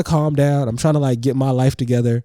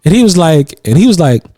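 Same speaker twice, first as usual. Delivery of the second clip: ordinary delivery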